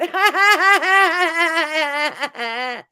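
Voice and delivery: high, loud shrieking cackle; mock cackle. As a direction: cackle like the woman in the clip. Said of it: "that cackle was interesting".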